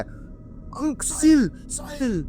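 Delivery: high, stretched cry for help, breaking to a whisper; panicked cry. Retold or imitated. Ankur crying out to Sahil while being dragged, terrified high call. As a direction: call his friend's name in terror, pitch way up. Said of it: "और अंकुर चिल्ला रहा है".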